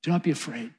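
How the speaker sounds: softly, in one connected phrase; quiet comfort at an easy, flowing pace; soft reassurance. Acted. Jesus repeating his comfort to John, soft reassurance. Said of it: "says to John".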